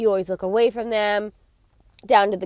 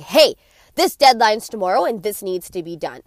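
louder, direct and commanding; firm assertive boss; 6 dB louder than usual. Retold or imitated. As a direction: tell the employee the deadline is tomorrow, no softening. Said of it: "you're like".

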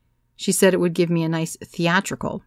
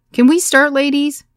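higher, louder call trying to cut through chatter; straining over noise; 9 semitones higher, 8 dB louder than usual. A narrator's reading. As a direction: push the voice above a noisy room. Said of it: "my voice barely cracking above the cacophony".

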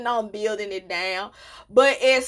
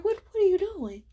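high, sassy mock question; mock indignant; 8 semitones higher, faster than usual. To daddy who forgot the rhyme, high mock indignation. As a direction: ask it like a pouting kid. Retold scolding dad.

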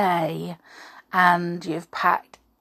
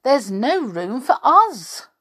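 exclaiming; 5 semitones higher, 5 dB louder than usual. Acted. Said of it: "exclaimed Minnie".

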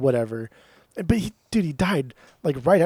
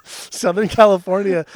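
laughing; 7 semitones higher, 6 dB louder than usual. Real, not acted. Laughing at Galactic Basic joke.

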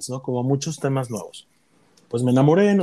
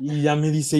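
usual speaking voice

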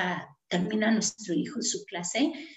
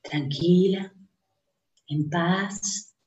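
guided meditation; 3 semitones lower than usual. Acted. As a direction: lead them gently into calm breathing.

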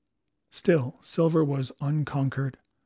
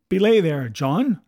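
sharp warning; 3 semitones higher, 7 dB louder than usual. A narrator's reading. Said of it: "said Mary".

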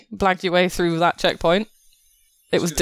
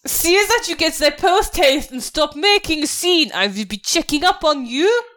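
higher, demanding officer voice; officious general; 11 semitones higher than usual. Acted. Officer scolding soldiers at the checkpoint, officious and higher-pitched. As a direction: order them about as a fussy officer. Game master plays the officer.

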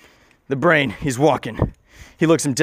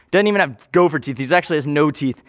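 ordinary delivery, nothing marked

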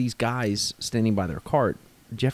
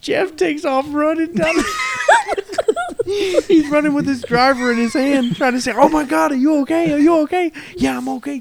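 high, loud, cracking up through the words; laughing while telling; 5 dB louder than usual. Real, not acted. Golfer retelling his friend hitting a stranger, laughing hard. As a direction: squeal the story out through real laughter.

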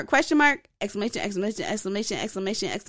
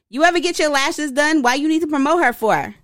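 louder, hammed-up all-caps read; mock caps yell; 5 semitones higher, 4 dB louder than usual. Shown as a demonstration. Reading her all-caps texts aloud, a light mock yell. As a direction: shout her texted questions in fun. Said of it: "I'm yelling because capitals are loud".

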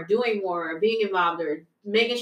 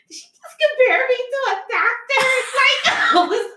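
high, loud, half-laughing disbelief; excited disbelief; 5 dB louder than usual. Real, not acted. Telling her friend about mom's praise, amazed and excited. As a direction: voice shooting up in amazed delight.

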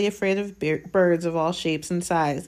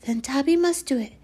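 high pleading house-elf; squeaky house-elf; 8 semitones higher than usual. Acted. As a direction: plead like a nervous little elf. Dobby's tragic resolve.

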